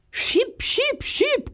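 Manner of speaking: squawky high bird chatter; loud blackbird scolding. Acted. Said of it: "ganz laut".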